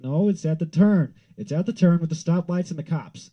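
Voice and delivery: loud, high shouted directions; a dad shouting directions on the phone, loud and high; retold shout. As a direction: yell it down the phone.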